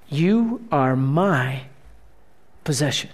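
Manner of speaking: God tells his people they belong to him, tender; tender divine whisper-like line. Acted. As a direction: breathe it out gently. Voicing God softly.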